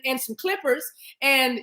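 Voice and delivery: ordinary talk, nothing marked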